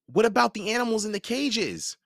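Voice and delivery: put-on narrator's boy voice; young boy asking. A narrator's reading. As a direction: voice Simon as a worried kid. Boy asks about caged animals.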